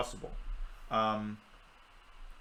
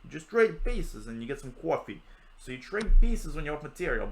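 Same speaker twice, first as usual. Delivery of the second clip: louder, hammed-up New York voice; New York accent; 3 semitones higher, 5 dB louder than usual. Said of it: "in a New York accent".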